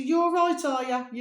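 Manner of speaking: bright, high, friendly "you're all right" call; cheery neighbour greeting. Retold or imitated. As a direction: greet her like a chirpy neighbour. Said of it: "You know, like this".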